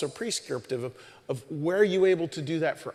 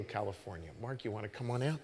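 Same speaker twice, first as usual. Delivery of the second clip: ordinary talk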